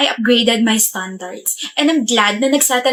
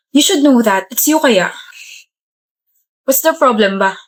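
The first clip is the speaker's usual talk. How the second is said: usual speaking voice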